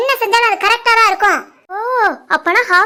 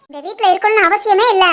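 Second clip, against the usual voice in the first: ordinary delivery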